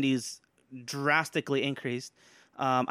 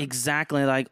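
ordinary talk

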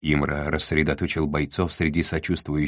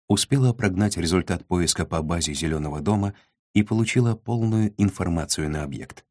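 usual speaking voice

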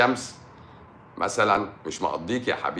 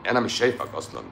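usual speaking voice

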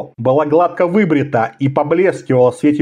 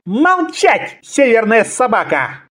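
very high-pitched angry command; cutting off; 7 semitones higher, slower than usual. A narrator's reading. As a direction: interrupt the prisoner with an insult. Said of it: "старший ассасин оборвал его".

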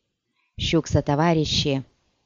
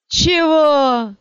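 high loud indignant yelp; outraged what; 11 semitones higher, 6 dB louder than usual. Acted. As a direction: explode in disbelief. Sergeant outraged at question.

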